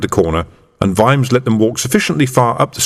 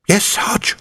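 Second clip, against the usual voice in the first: breathless unvoiced whisper; a young constable whispering back; 11 semitones higher, 3 dB quieter than usual. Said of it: "whispered Sam".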